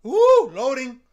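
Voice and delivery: a jumpy real squeal; high excited squeal. Real, not acted. Jumpy reaction to loading screen.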